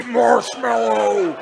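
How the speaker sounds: slow, drawn-out strained shout; acted cry for help; desperate cry. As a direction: stretch the word into a helpless wail. Crying safe word in bear's grip.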